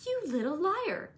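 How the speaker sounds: mild furious outburst; angry girl's accusation, understated; panted fury. A narrator's reading. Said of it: "she panted".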